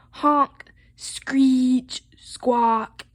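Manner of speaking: loud, drawn-out honking words; storybook racket effects; saxophone noises. A narrator's reading. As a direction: blare each word like a squawky horn.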